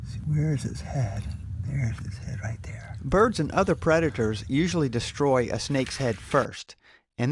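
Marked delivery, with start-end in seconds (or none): 0.1-3.0 hushed whisper near the snake. Real, not acted. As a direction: whisper it softly.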